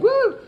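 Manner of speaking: hooting peacock cry; peacock woo. Shown as a demonstration. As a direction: whoop like a peacock. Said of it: "the sound you heard me going".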